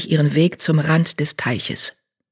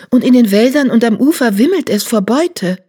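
eager; 7 semitones higher, 4 dB louder than usual. A narrator's reading. Excited cat.

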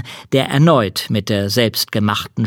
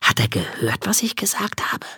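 whispering; 5 dB quieter than usual. Acted. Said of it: "fragt sie Lasse".

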